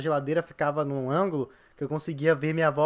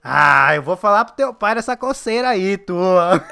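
threatening grandma; 4 semitones higher, 8 dB louder, slower than usual. Retold or imitated.

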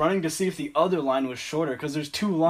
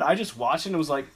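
usual speaking voice

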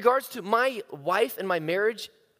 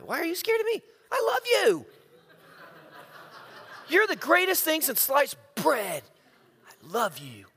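much higher, pleading and hammed-up; 8 semitones higher than usual. To scared summer staff, hammed-up high pleading. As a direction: overdo the affection, almost squeaky.

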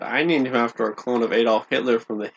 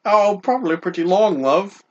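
put-on higher, cheeky British accent calling him love; mock British accent; 7 semitones higher than usual. Co-host keeping up a mock British accent for the host. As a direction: play the cheeky Brit. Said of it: "talk like this".

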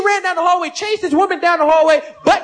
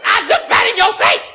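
angry shout; 8 semitones higher than usual. Acted. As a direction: yell at the liar. Wife shouting at cheater.

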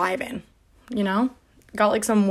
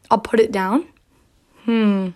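normal talking voice

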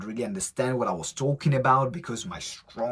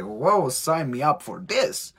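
louder and slow, savoring it; slow, louder, delighted whoa; eager whoa; 3 semitones higher, 7 dB louder, slower than usual. Retold or imitated. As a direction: sell it like you can't wait to join. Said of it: "I was like".

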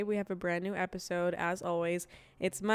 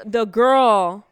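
ordinary delivery

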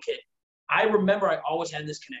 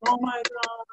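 high gushing delight; high-pitched puppy gush; excited; 8 semitones higher, slower than usual. Retold or imitated. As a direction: gush over a new puppy. Owner meeting a dog.

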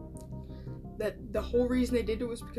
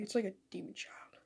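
whispered aside; 2 semitones lower, 9 dB quieter than usual. Real, not acted. Joking aside about the podcast.

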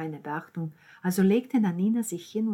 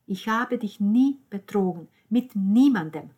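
loud, raised, emphatic plea; insistent; 4 semitones higher, 9 dB louder than usual. Acted. Alessandro swears to Roberta he was faithful, insistent. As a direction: press each word of the denial.

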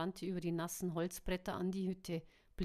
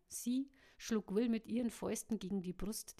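ordinary delivery

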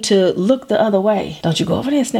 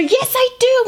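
put-on insistent toddler; 9 semitones higher than usual.

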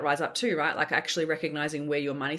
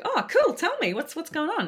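much higher and louder, eager to hear; eager curiosity; 12 semitones higher, 4 dB louder than usual. Retold or imitated. To friend in the car, eagerly curious. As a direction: leap up in pitch, keen to know. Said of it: "And I'm like".

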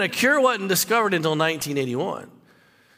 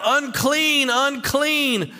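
raised, high calling cry of a leper; crying out unclean; 6 semitones higher, much slower than usual. Retold or imitated. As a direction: call the warning out so people keep away. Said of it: "he's gotta cry out".

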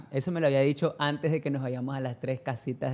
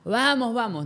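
a hint of a fussing mom, higher; lightly voiced mom hurrying dad along; mock fussy mother, pitch up; imitating the mother; 8 semitones higher, slower than usual. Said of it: "y mamá".

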